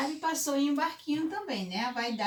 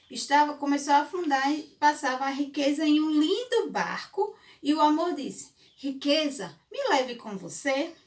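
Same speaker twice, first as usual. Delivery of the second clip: put-on storytelling to children; 3 semitones higher, 4 dB louder than usual. A narrator's reading. Fable narration with pleading Amor.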